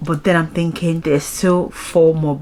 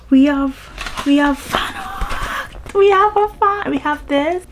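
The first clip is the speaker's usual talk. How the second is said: much higher, bright excited repeats; thrilled squeal; 12 semitones higher, slower than usual. Real, not acted. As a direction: gush like a fan who can't believe it. Excited about fan art found.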